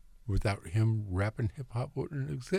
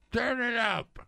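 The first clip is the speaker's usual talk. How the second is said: high, loud shout; yelling into the mic; 5 dB louder than usual. Real, not acted. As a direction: yell it out at full volume. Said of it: "Not if you are going to yell into the mic".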